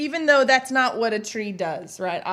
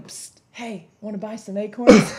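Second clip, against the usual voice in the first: psst, hushed shady pitch; secretive raspy sales murmur; 2 semitones lower, 7 dB louder than usual. Retold or imitated. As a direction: whisper the deal like a dealer. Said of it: "this is a tree hustling".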